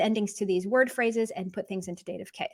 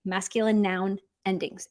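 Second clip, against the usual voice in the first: ordinary talk, nothing marked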